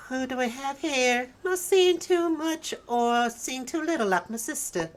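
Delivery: a hint of a chatty old lady; new old lady voice. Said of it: "let me think of another voice here".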